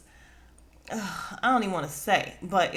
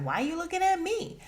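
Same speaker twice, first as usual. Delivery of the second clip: high put-on voice playing innocent; high, falsely innocent Gizelle imitation; mock innocent; 11 semitones higher than usual. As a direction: pretend you have no idea why they stare. Gizelle mocked, feigning innocence.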